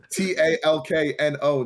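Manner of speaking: slowly, letter by letter; spelled out. Retold or imitated. Storyteller mockingly spells his refusal to the date, letter by letter. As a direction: spell out T A L K N O one letter at a time. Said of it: "He spelled it out".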